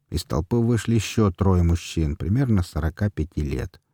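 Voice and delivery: plain reading voice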